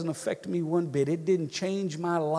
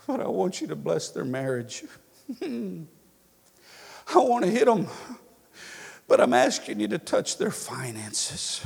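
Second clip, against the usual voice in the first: prayer; 3 semitones higher than usual. Shown as a demonstration. Preacher voicing a hard prayer.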